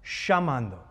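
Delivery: "Llamando" with ll said as sh; "Llamando", ll as soft sh; Argentine sh. Shown as a demonstration. Preacher repeats the Argentine "Llamando" with sh. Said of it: "that's the way Italians pronounce a double L".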